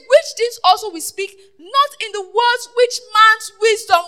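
loud, emphatic sermon delivery; full-force preaching. Real, not acted. Preacher quoting scripture to the congregation, full-force preaching. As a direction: drive the scripture home to the congregation.

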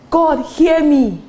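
high, drawn-out mock plea; mock pleading. Preacher mimicking a doubtful prayer to God, high pleading. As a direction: stretch it out like someone begging heaven. Acts a desperate prayer he rejects.

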